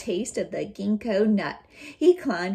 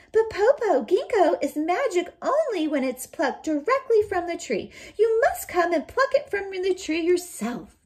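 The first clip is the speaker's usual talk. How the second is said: put-on coaxing child; 9 semitones higher, 5 dB louder than usual. Child coaxing wolf from tree.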